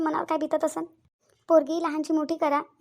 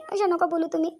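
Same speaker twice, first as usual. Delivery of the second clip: normal talking voice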